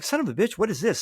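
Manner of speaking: high-pitched retold exclamation of dismay; mock indignant disbelief. Lawyer reacting to a complaint filed against him, mock indignant. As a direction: act out a lawyer caught off guard.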